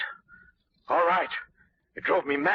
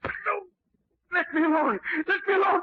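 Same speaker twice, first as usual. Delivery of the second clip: panicked pleading; faster than usual. Acted. Gardener cornered by Shadow.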